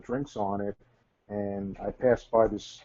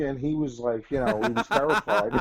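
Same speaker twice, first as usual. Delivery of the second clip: ordinary talk, nothing marked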